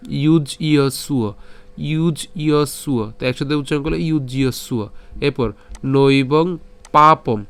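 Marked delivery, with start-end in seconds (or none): 0.1-3.1 ইউজ ইয় সুয় slowly, each syllable separate; syllables joined slowly. Shown as a demonstration. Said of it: "যদি এই পুরোটি যদি একসাথে উচ্চারণ করি". 5.8-7.4 নৈবং পাপম very slowly, stopping at পাপম; slow, clear pronunciation. Shown as a demonstration. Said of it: "এখানে আমরা পাপম পর্যন্ত উচ্চারণ করলাম".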